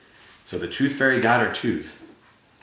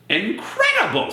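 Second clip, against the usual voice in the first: amazed; 10 semitones higher than usual. Acted. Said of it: "said the tooth fairy".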